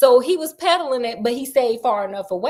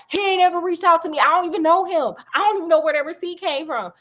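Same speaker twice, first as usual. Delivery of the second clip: high, fast, heated protest; indignant denial; 6 semitones higher, faster than usual. Retold or imitated. Doctor snapping at Sherlock over the receipt, heated and high. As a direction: fire back like you're insulted. Doctor denies, retold quarrel.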